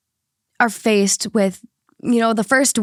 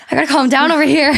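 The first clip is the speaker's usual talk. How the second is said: huffing, a bit louder and fast, short of breath; talking through heavy breaths, faster; 4 semitones higher, 4 dB louder, faster than usual. Real, not acted. Worked up about the story, out of breath and louder. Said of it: "Huffing and puffing over there".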